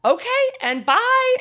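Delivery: high, slow, stiff farewell voice; awkward goodbye. Retold or imitated. Retelling a date's end, awkward high goodbye. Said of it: "So I was like".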